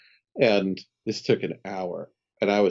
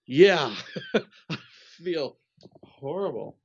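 laughing through it; 8 semitones higher, slower than usual. Retold or imitated. Retelling own reply, laughing.

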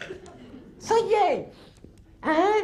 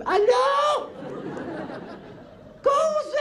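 shouting; 7 semitones higher, much slower than usual. Acted. Shouting into the phone.

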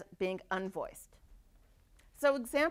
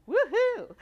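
cheer; 11 semitones higher, 10 dB louder than usual. Real, not acted. Cheering vocal cords in action.

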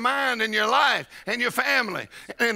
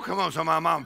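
ordinary talk

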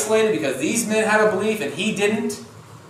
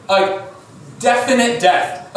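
ordinary talk, nothing marked

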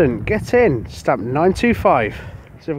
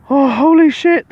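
a high, loud burst of disbelief; excited shout; 11 semitones higher, 7 dB louder than usual. Real, not acted. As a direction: yell it out as the gold is confirmed. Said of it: "Sorry about the swearing".

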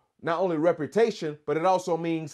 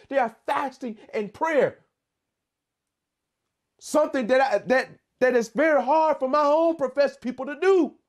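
God pleading with Jonah; 7 semitones higher than usual. Acted. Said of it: "God was trying to teach him, like".

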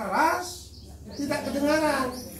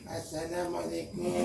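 limp, much softer greeting, as if timid; weak soft greeting; 7 semitones lower, 8 dB quieter than usual. Shown as a demonstration. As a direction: say it softly and limply to show what not to do. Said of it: "memang nggak boleh lembek". (salam lemah dan pelan)

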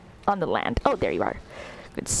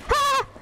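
sudden loud startled yelp; scared yelp; 4 dB louder than usual. Real, not acted. Player scared by monster, startled yelp. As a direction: yelp in fright.

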